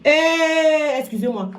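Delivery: ordinary delivery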